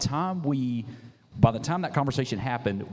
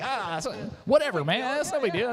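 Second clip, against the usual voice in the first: much higher, breezy shrug; imitating Ty; 13 semitones higher than usual. Quoting Ty shrugging off the sponsorship, high breezy voice. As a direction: brush it off lightly. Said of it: "he's just like".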